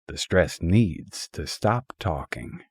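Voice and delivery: normal talking voice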